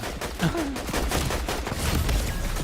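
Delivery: breathy high-pitched giggle; high laugh. Real, not acted. Streamer laughing at a friend who ignores him, high breathy laugh.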